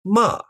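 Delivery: MA starting high, falling all the way; falling fourth tone. Shown as a demonstration. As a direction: drop "Ma" sharply from the top. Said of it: "starts high and falls all the way down".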